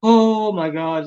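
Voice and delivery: slow, high astonished oh my god; stunned delight at a lucky find; drawn-out excited disbelief; amazed gasp. Retold or imitated. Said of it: "and I was like".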